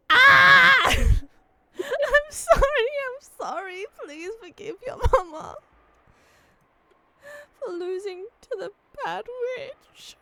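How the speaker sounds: loud, high, hammed-up crying; wailing apology, breaking into laughs; mock sobbing.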